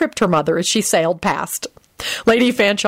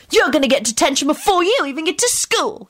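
put-on high-pitched boyish threat; indignant little boy's warning; threatening; 10 semitones higher than usual. A narrator's reading. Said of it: "told the boy threateningly".

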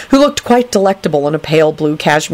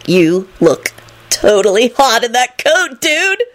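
drawn-out gush rising high; slow, building fanboy ecstasy; ecstatic shriek; 5 semitones higher, slower than usual. A narrator's reading. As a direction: gush in rapture, climbing to a squeal. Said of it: "he shrieked ecstatically".

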